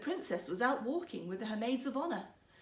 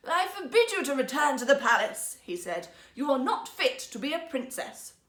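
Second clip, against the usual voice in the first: loud, stern emperor; stern banishment; 3 semitones higher, 8 dB louder than usual. Acted. Emperor to his daughter, loud stern banishment. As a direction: lay down the law to her.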